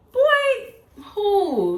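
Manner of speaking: high, loud, laughing disbelief; incredulous brush-off. Retold or imitated. To her boyfriend insisting she's pregnant, a high laughing scoff. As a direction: scoff at him through a laugh.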